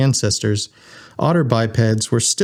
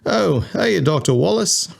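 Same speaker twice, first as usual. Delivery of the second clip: higher, cheery Australian greeting; Australian ship's computer, pleasantly surprised; friendly Aussie; 7 semitones higher than usual. A narrator's reading. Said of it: "in his friendly Aussie voice".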